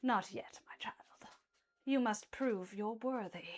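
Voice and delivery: low ominous whisper. Acted. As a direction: hush it like a secret from a giant. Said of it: "he goes".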